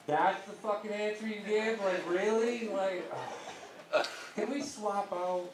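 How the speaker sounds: exasperated family. Retold or imitated. Family mocks the answer.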